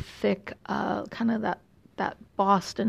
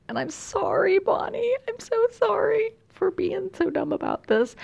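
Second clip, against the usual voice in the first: squeaky high, hammed-up sorry; a light pleading mock apology to Bonnie; high-pitched playful sorry; 12 semitones higher than usual.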